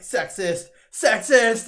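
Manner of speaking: high, loud indignant shriek, rising; mock shrieking Yuffie. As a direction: shriek it back at him, offended. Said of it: "And she's like".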